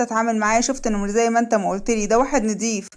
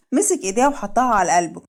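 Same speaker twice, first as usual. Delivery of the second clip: usual speaking voice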